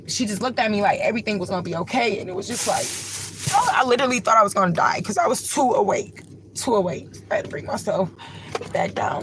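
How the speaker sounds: holding back sobs as she recalls the smile; tearful. Real, not acted. Telling her viewers about the stranger's smile, tearful. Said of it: "it's making me emotional".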